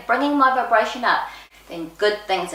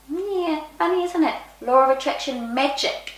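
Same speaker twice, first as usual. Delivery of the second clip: knowing inner voice; 7 semitones higher than usual. Retold or imitated. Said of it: "in my head I was going".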